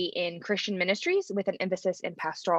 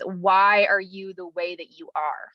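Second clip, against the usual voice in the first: deadpan. Retold or imitated. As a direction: imitate a completely deadpan boss. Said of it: "completely deadpan, he says".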